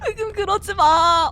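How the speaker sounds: slow, whimpering plea to the music; whiny plea. Real, not acted. Streamer pleading with spooky game music, slow whimper. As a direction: beg the music to stop, half crying.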